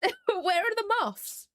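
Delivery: high, slow laughing question. Real, not acted. Streamer laughing while hunting for moth emotes, high laughing question.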